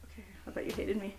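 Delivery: fast, hushed mock hurt; whisper. Girl whispering mock hurt to her friend, fast whisper. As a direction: breathe it out like a wounded aside.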